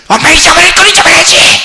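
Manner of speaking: put-on high, loud indignant child's yell. Spoiled child yelling back at apologizing mom, high loud yell. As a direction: shriek at mom like a spoiled kid. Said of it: "지금 누가 누구한테 소리를 질러야 되는 거야?".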